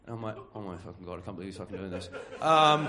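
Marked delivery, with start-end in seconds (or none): 0.5-2.1 softer, muttered aside of disbelief; under-breath disbelief. Retold or imitated. Employee muttering in disbelief at his boss, softer. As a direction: mutter it to yourself, stunned.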